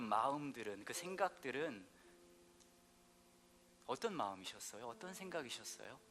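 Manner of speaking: quiet, hushed prayer over music; gentle pastor's prayer with whispered lines; soft closing prayer. Acted. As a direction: draw the room into prayer.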